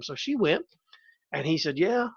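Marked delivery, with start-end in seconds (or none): 1.8-2.1 slow, lazy Southern drawl, lightly done; an easy-going Southern drawl. Retold or imitated. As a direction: stretch it out like an old Southern farmer. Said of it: "kind of slow drawl and all that stuff".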